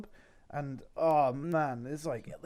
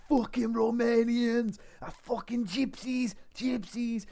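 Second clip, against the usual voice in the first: much higher, slow, louder Russian rant; hammed-up drunk Russian raging; Russian accent; 10 semitones higher, 3 dB louder, slower than usual. The agitated Russian cursing Romanians, in a high, slow Russian accent. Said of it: "So it's going".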